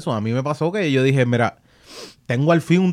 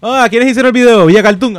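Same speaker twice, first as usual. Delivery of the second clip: excited fans; 8 semitones higher, 7 dB louder than usual. Pretend. Imagined fans asking loudly.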